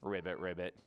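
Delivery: low frog "ribbit"; deep, playful frog noises; frog croak. Pretend. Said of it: "This is frog in sign language".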